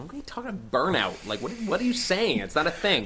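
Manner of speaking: high incredulous scoff at the idea; scoffing disbelief. Retold or imitated. Mocking his past self, scoffing that burnout isn't real.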